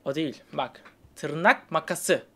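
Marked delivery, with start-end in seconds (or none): 1.2-2.2 kelimeleri yavaşça, tane tane söylüyor; "Tırnak makası" yavaş ve özenle, örnek verir gibi; yavaş gösterim. Shown as a demonstration. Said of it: "Ses tonunuza bakacağım". (yavaş, örnek gösteren ses)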